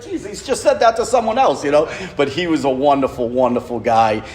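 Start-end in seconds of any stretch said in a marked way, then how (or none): 0.0-1.6 wry realization. Retold or imitated. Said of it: "I was like".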